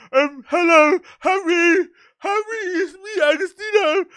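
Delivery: high, squeaky starstruck fan; nervous fan voice. Retold or imitated. Fan meeting [name], high nervous gushing.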